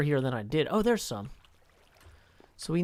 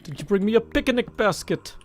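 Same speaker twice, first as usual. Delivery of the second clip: louder folksy cartoon-bear voice; Yogi Berra impression; 3 dB louder than usual. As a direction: ham up the bumbling bear greeting friends. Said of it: "That's an impersonation of famous".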